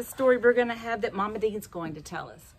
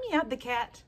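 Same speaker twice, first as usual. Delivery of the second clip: ordinary delivery, nothing marked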